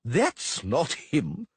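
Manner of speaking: slow, mild mock bellow. A narrator's reading. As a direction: drag it out indignantly. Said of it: "the toga bellowed".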